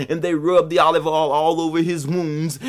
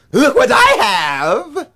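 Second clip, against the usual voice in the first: high, loud, slow crowing; boastful cry; 9 semitones higher, 6 dB louder, slower than usual. A narrator's reading. Anansi showing off to the pot, boastful cry. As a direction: crow it out, stretching the words.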